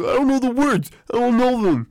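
put-on high, loud whining kid voice; whiny little brother. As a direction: whine it like a stalling kid brother. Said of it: "he's like".

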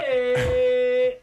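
loud delighted yelp; excited shout. Real, not acted. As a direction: cheer it out. Cheering the cover news.

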